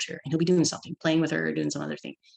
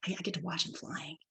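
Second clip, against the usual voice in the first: hushed, breath-only realisation; soft imitated child's wonder; awed whisper; 10 dB quieter than usual. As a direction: whisper it as it dawns on you.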